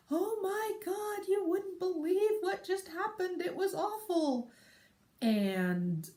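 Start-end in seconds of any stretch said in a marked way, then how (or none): 0.1-4.5 put-on high, slow, gushing little girl; dramatic child. Daughter running out after wetting the carpet, high and dramatic. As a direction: play it as a tragic child drama. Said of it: "she came running out like".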